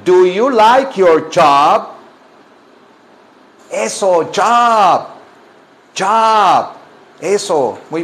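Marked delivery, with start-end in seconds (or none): 0.0-1.9 very slow, drawn-out question; deliberate word-by-word drill; slow repeat-after-me. Shown as a demonstration. 4.3-6.7 higher "job" with a ch-like start; drawn-out "job" opening on a crisp ch sound; correct "job". Shown as a demonstration. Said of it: "se dice job".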